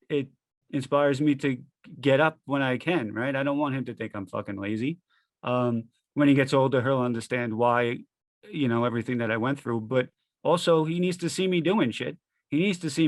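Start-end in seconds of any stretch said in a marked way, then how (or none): none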